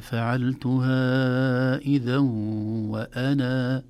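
وأنا with long final alif at the stop, very slow; وأنا ending in a full long a; pausal long alif. Shown as a demonstration. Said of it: "لكن لو وقفنا".

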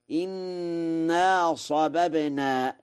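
إنا with kasra, inna, slow chanted. Shown as a demonstration. Lecturer chanting Warsh's إنا with kasra on the hamza. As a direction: intone إنا with i, not a. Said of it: "بكسر همزة إنا".

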